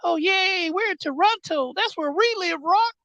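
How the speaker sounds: very high, silly mimic of cheering fans; mocking crowd voice. The Rock mocking the Toronto crowd in a very high silly voice. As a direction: squeak it out like a dumb fan, mocking. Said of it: "he made fun of them immediately".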